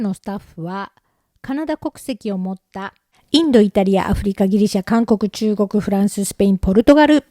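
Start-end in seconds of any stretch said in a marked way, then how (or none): 3.3-7.2 much louder announced list; punchy, forceful listing; loud roll call. Real, not acted. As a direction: reel off the countries like a proclamation. Reels off long list of countries.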